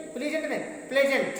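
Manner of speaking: प्लीजेंट is wrong, say प्लेजेंट with a short e; correcting pronunciation. Shown as a demonstration. Teacher correcting students: say प्लेजेंट, not प्लीजेंट. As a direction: stress that प्लेजेंट has a short e, not a long ee. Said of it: "प्लीजेंट नहीं बोलेंगे".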